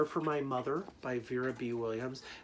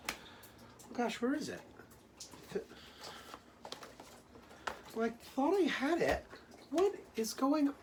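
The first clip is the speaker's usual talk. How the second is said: high, puzzled searching voice; mock confusion; 12 semitones higher than usual. Teacher pretending she lost the book, puzzled. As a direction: act puzzled, pitch up high.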